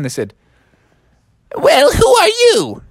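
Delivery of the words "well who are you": put-on very high, slow old-man voice; kindly old man. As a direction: ask in a drawn-out, quaint elder voice. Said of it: "kindly old man".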